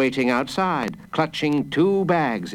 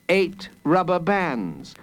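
ordinary delivery, nothing marked

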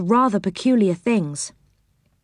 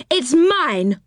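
mock shout; 8 semitones higher than usual. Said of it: "shouted Moonface".

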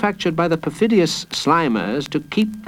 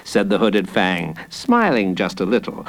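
plain reading voice

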